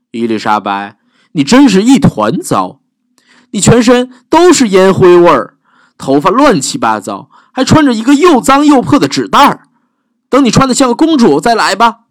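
high, sniffy scolding; snobby prince. Acted. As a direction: look down your nose at her.

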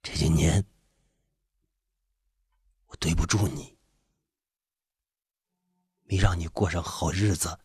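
low, husky apology; subdued, hoarse, sorrowful husband. A narrator's reading. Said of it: "他哑哑地说".

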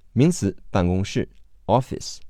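normal talking voice

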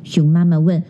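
plain reading voice

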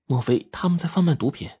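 thoughtful musing. A narrator's reading. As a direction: think it through as you say it. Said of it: "若有所思地说".

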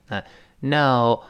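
normal talking voice

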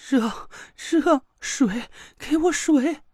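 high desperate pleading cry; crying out in pain. Acted. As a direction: beg for water, burning up. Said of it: "田慧文痛苦地哭喊".

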